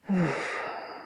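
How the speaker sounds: long, falling sigh; put-upon exhale; exasperated sigh. Acted. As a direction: breathe out a fed-up sigh. Witch fed up with noise.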